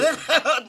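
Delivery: high, loud gleeful creature cackle. Acted. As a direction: cackle with sly delight. Gollum cackles as hobbits wake.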